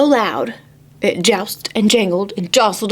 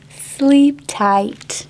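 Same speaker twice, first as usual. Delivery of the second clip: ordinary delivery, nothing marked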